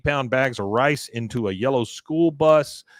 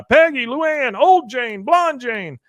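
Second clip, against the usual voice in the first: calls each name high and loud; shouting names; 12 semitones higher, 6 dB louder, slower than usual. Retold or imitated. Hank at the locked gate, shouting names high and loud. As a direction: yell for the women over the gate.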